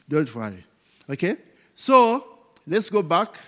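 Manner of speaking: ordinary talk, nothing marked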